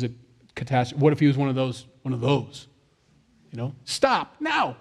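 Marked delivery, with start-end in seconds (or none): 3.8-4.8 high sharp yell of stop now; shouted command. Retold or imitated. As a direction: yell it like a scared parent. Parent yelling at child.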